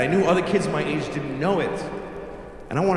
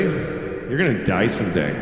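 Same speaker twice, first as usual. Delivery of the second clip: deadpan threat; 7 semitones lower than usual. Retold or imitated. Child coldly tells rival he'll die.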